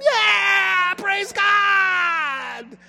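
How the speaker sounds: high, loud "praise God" shout; excited praise shout. Pretend. Pastor showing the church how to respond: excited shout. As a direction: cheer it out like a revival crowd.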